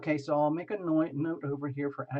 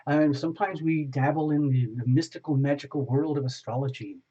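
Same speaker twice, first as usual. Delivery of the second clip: louder, upbeat broadcast host voice; show host opening; 5 dB louder than usual. Acted. Host welcoming viewers to Wellness Wednesday, upbeat announcer voice.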